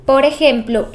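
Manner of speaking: usual speaking voice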